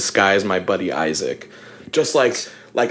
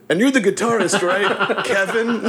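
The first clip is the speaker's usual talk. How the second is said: high, laughing "Kevin?"; teasing echo breaking into laughter; laughing mock echo; 9 semitones higher than usual. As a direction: parrot it back with a giggle. Band member voiced mimicking back.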